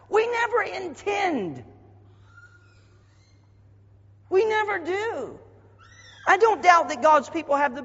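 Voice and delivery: preaching at full force. Acted.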